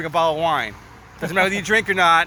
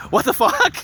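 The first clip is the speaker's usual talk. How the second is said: high, louder startled outburst; shocked coworker; 13 semitones higher, 4 dB louder than usual. Retold or imitated. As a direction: blurt it out, stunned. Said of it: "he's like".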